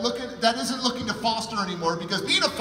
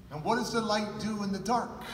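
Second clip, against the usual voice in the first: usual speaking voice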